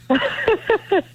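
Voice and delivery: short, high burst of real giggling; helpless giggle. Real, not acted. Guest who can't stop laughing, helpless giggle. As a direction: giggle that slips out after apologizing. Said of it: "I can't stop laughing".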